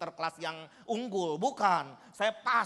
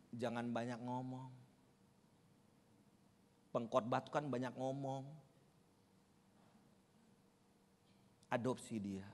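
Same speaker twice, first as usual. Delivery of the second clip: much softer, low words of God; solemn divine voice; 6 semitones lower, 8 dB quieter than usual. Retold or imitated. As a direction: quietly voice God's command. God speaking in the sermon.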